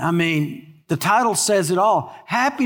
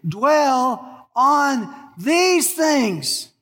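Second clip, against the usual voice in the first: higher and very slow, drawn out; slow emphatic close; 6 semitones higher, much slower than usual. Real, not acted. Preacher lingering on the verse's final words, very slow. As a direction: let each word land. Climax of scripture reading.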